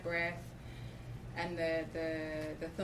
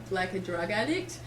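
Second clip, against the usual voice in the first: usual speaking voice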